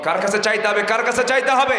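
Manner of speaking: loud rising call to the crowd; preacher calling out to the gathering, loud and high. Real, not acted. Waz preacher questioning the crowd, loud call.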